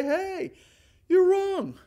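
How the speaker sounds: high, loud call of warning; urgent warning. Acted. Preacher voicing loving correction, urgent loud call. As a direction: stop them in their tracks.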